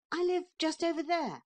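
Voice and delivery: high, quick little boy pretending not to care; boy's defiant voice. A narrator's reading. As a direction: act brave as a small boy. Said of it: "said Neville, pointing towards his home".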